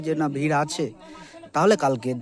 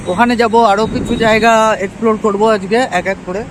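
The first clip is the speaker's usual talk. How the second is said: loud and raised over wind noise; shouting over wind; 4 semitones higher, 5 dB louder than usual. Real, not acted. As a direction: push the voice over the road noise. Talking to camera while riding.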